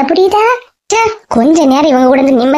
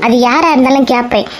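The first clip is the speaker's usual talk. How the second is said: usual speaking voice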